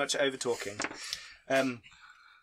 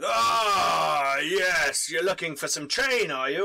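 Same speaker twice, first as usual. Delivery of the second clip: big armour dealer; 2 semitones higher, 6 dB louder, much slower than usual. Acted. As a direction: boom it out like a giant shopkeeper. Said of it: "And he's like".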